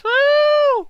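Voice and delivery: high, loud echoing crowd woo; fake audience cheer. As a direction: cheer like a whole studio audience. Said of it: "Fake audience".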